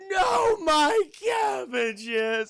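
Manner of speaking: high, loud, very drawn-out wail; hammed-up cry of despair; wailing Cabbage Man. Cabbage seller watching his stock destroyed, mock-anguished wail. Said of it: "The Cabbage Man".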